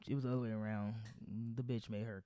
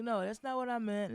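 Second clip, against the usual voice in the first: higher, flustered backtracking; whiny higher excuse; mock backpedal; 8 semitones higher than usual. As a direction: play her scrambling to take it back. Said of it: "she was like".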